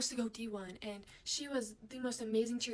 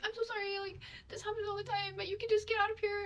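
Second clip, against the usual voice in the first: much higher-pitched, pleading sorry; high, anxious mimicry of a son; imitated anxious apology; 9 semitones higher than usual. Same son apologizing to her.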